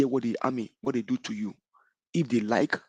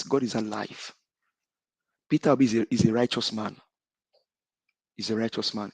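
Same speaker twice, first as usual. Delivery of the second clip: ordinary delivery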